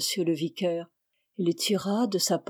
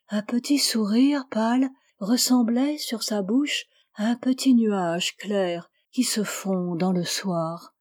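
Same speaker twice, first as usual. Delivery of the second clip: usual speaking voice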